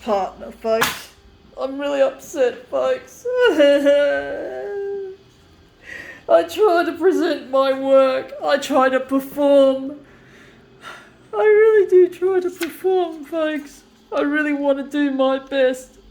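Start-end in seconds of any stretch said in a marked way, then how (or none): 3.3-5.1 tearful laugh-sob. Real, not acted. Upset about missing partner. 6.3-13.2 tearful pleading. Real, not acted. Pleading about his work.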